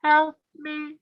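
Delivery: very high, small plea; tiny distant voice. Acted. Trapped man calls to husband, tiny high voice. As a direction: squeak it like someone trapped far inside. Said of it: "he just hears a little".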